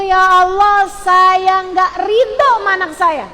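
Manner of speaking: high, hard-hit declaration of rejection; forbidden angry prayer. Shown as a demonstration. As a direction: demonstrate the wrong prayer forcefully. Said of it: "Jangan sampai keluar dari mulutmu".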